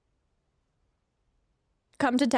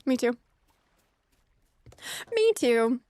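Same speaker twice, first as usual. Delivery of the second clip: usual speaking voice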